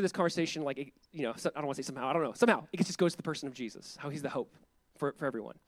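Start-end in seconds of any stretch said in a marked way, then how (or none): none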